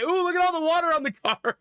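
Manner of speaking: put-on high, wondering kid voice; imitating a wide-eyed three-year-old; delighted toddler. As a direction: marvel like a small child. Toddler marvels at rain.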